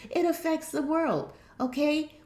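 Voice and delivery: lifted, pressing pitch. Shown as a demonstration.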